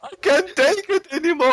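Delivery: high hammed-up despair; mock despair. Boris giving in to the vodka, high and in mock agony. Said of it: "they're like".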